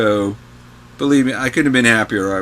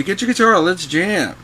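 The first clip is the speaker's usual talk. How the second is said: high, eager call to jam; Tom inviting; 8 semitones higher than usual. Retold or imitated. Mentor Tom calls the teen to grab his guitar, high and eager. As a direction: pitch it way up like a buddy pulling you in. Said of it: "and he would say".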